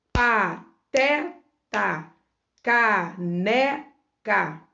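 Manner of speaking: PATETA and CANECA very slow, each in three beats; pa-te-ta, ca-ne-ca, gaps between syllables; split into syllables. Shown as a demonstration. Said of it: "eu abri a boca".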